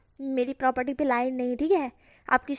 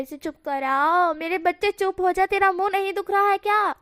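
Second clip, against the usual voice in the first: put-on higher, louder, fed-up plea to the child; exasperated scolding; 6 semitones higher, 5 dB louder than usual. Mother begging her son to stop talking, exasperated.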